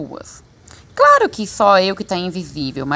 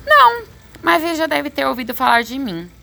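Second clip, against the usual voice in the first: higher-pitched, breezy boast; cocky child reply; 7 semitones higher than usual. A narrator's reading. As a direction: answer like a self-assured kid, pitched up. Boy boasts in reply to father. (resposta convencida, em voz mais aguda)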